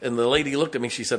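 usual speaking voice

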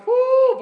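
very high, loud "woo"; delighted whoop. Acted. Preacher imagining a red Lamborghini mid-prayer, delighted whoop. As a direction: whoop with comic longing.

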